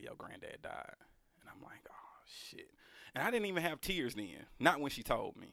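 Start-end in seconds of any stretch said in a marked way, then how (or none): 0.0-1.0 near-whispered breaking news; voiced under the breath, stunned; a sister's hushed news, whispered; hushed retelling. 1.4-2.7 whispered shock under the breath; whispered disbelief; stunned whisper. Retold or imitated. Reacting to grandfather's death.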